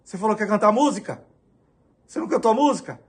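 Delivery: retold angry reproach to the pastor; angry confrontation. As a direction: confront him on the stairs, furious. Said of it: "Eu já dei um enquadro".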